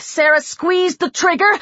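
ordinary delivery

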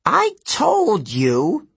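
put-on high, slow, huffy old satyr protest. A narrator's reading. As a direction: drag out the objection. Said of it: "the satyr protested".

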